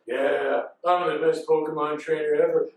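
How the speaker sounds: low, smug villain boast; Mewtwo. Retold or imitated. Mewtwo with all the Pokemon, deep smug boast. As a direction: drop deep and self-satisfied. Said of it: "and then Mewtwo's like".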